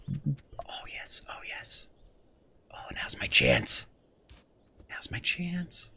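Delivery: hushed, breathless plotting; scheming whisper. Pretend. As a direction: whisper as you sneak up. Sneaking goose whispers.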